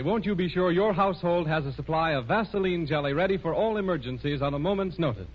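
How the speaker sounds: ordinary talk, nothing marked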